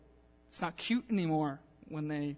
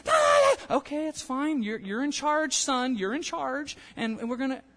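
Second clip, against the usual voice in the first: high yelp, then higher and louder giving-in voice; placating mom; 7 semitones higher, 5 dB louder than usual. Acted. Mother surrendering to her toddler's tantrum, high and loud. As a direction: squeal and cave in to the child.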